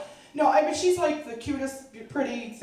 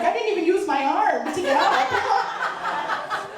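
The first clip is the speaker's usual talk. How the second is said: much higher, slow, preening boast with a laugh; proud strut; 11 semitones higher, 4 dB louder, slower than usual. Retold or imitated. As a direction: gloat like a supermodel. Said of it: "I will strut to the kitchen like".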